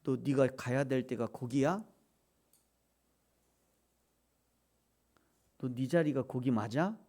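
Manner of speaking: low, soft probing questions; quiet, low reproof from God; still small voice. Acted. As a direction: ask gently, lifting the last word. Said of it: "하나님이 세미한 음성으로 그렇게 말씀".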